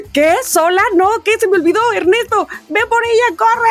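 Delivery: high-pitched panicked orders to the husband; retold panic. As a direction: yelp it like a mother who just forgot her kid. Mother panics, daughter alone.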